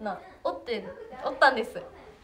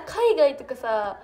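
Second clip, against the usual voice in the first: ordinary delivery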